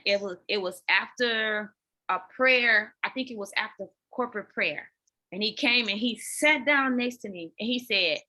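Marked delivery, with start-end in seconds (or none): none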